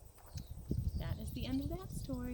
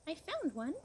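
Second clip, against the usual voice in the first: much higher, like a small bird; sparrow voice; 11 semitones higher, faster than usual. A narrator's reading. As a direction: pipe it up high like a polite little sparrow. Sparrow offers a button.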